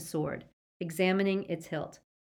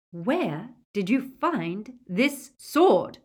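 loud, slow, higher-pitched interrogation; insistent demand; 5 semitones higher, 7 dB louder, much slower than usual. A narrator's reading. Witch brandishes sword at the werewolf, slow loud demand. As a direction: space out each word menacingly. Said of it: "she repeated, brandishing it".

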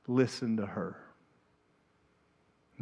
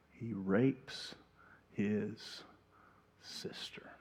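whispered; 3 semitones lower, 11 dB quieter, much slower than usual. Real, not acted.

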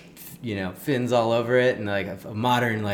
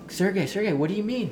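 much higher, anxious, pleading call; panicked call; 12 semitones higher than usual. Retold or imitated. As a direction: beg him to explain, alarmed. Friends alarmed, calling the guide.

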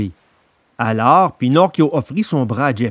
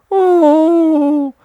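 high, slow, drawn-out whine; the fox begs pitifully; the fox whines at Pinocchio for charity; whining fox; 13 semitones higher, 4 dB louder, much slower than usual. A narrator's reading. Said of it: "d'une voix pleurnicharde".

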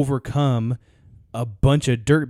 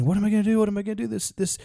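mock-panicked, much higher voice; hammed-up panic, pitched way up; panicking donkey; 9 semitones higher, much faster than usual. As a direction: squeal it like a frantic trapped animal. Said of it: "he started screaming and panicking".

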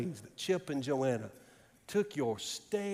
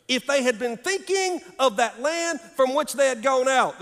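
high, loud, rolling sermon delivery; full-force preaching; 7 semitones higher, 11 dB louder than usual. Acted. Preacher to congregation on the promised city, preaching fervently. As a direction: preach it out with long-held key words.

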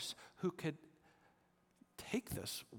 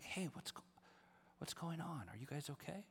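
softer, hushed, gentle check-in; soft concern; 2 semitones higher, 9 dB quieter than usual. Retold or imitated. Speaker bending over to weeping girls, softly concerned. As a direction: lean down and ask gently.

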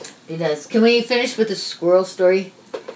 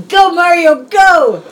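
cheering; 8 semitones higher, 6 dB louder, much slower than usual. Real, not acted. Cheering on the sneeze.

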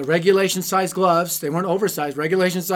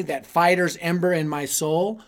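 normal talking voice

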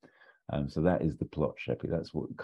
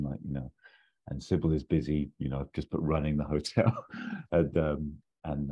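usual speaking voice

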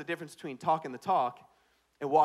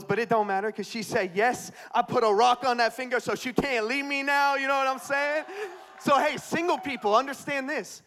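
high, loud preaching climax; rally the congregation; 7 semitones higher, 7 dB louder than usual. Real, not acted. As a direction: pastor lifting the crowd at full volume. Preaching at full force.

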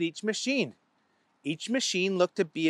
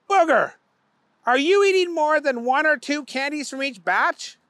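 high, pointed questioning boss voice; cross-examine the guilty worker; higher stern boss; 8 semitones higher, 5 dB louder than usual. A narrator's reading. Owner questions Booger.

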